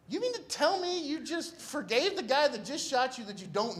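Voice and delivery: high-pitched retold disbelief; incredulous recap. Medics query patient forgiving his shooter, high disbelief. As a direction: question him high, unable to believe it.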